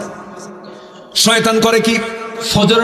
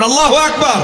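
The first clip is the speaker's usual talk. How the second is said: high, loud Allahu Akbar; louder takbir; 10 semitones higher than usual. Shown as a demonstration. Preacher leading the crowd in a loud আল্লাহু আকবার. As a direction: raise the crowd's voice. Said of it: "বলেন".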